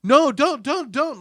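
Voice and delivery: high, loud pleading no, don't; yelling. Retold or imitated. Viewer pleads with the screen during a brutal scene, loud yell. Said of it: "I'm, like, yelling".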